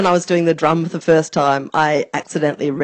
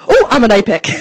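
ordinary delivery

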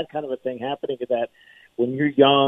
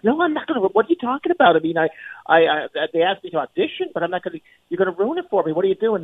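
high-pitched, agitated objection; flustered protest; 6 semitones higher, faster than usual. Retold or imitated. Retelling his protest to a radio station, flustered. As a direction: object in a panic, tripping over the words.

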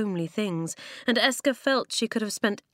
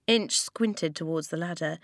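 usual speaking voice

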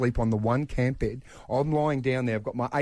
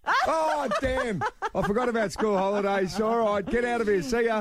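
laughing; 10 semitones higher than usual. Real, not acted. Dad laughing, shooing son off air.

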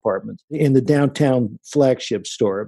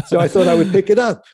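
quoting him through a laugh; chuckling out the punchline; amused, a laugh breaking into the words; 6 semitones higher, faster than usual. Real, not acted.

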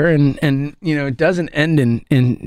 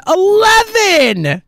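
drawn-out, loud, high "Eleven!"; exasperated outcry; 13 semitones higher, 3 dB louder, much slower than usual. Real, not acted. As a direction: stretch "Eleven!" slowly in loud disbelief. Exasperated fan stressing long drought.

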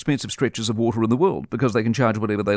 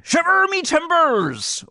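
high, slow hammed-up pirate cry; much slower than usual. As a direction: ham it up like a stage pirate. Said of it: "And say".

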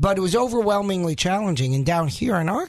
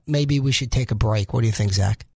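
ordinary talk

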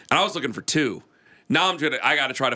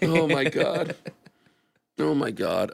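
laughing; 3 semitones higher, slower than usual. Real, not acted. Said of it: "I'm trying not to laugh".

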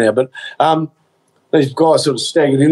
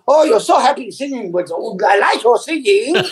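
gushing, higher mock Chinese accent; 6 semitones higher than usual. Shopkeeper complimenting his singing customer, gushing mock Chinese accent. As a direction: imitate a delighted Chinese-accented salesman. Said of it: "he kept complimenting me".

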